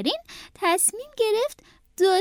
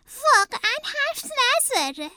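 very high cartoon rabbit voice; delighted rabbit; 11 semitones higher than usual. A narrator's reading. Howard popping gum in his mouth, high delighted voice. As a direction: savour it in a high character voice.

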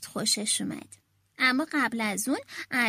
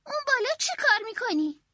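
alarmed mother; 6 semitones higher than usual. A narrator's reading. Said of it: "مادر جیغ کشید و گفت".

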